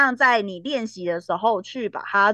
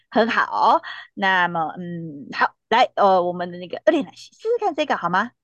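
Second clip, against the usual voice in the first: usual speaking voice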